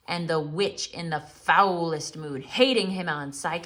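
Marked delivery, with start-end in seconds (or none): none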